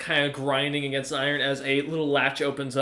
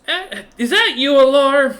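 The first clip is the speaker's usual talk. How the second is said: high, loud call of recognition; Rain greeting a comrade; 10 semitones higher, 8 dB louder than usual. Acted. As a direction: call out brightly in a high woman's voice. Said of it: "wipes off the dagger and goes".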